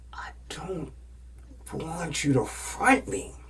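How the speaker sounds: whispered, slow, pleading. Retold or imitated. Refusing money from friend.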